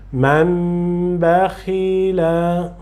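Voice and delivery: man bakhila very slow, n turned into a long nasal m before b; slow joined recitation. Shown as a demonstration. Teacher reciting man bakhila for the student, n merged into m. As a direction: glide man into bakhila as mamb, slowly. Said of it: "dizer ou falar man bakhila".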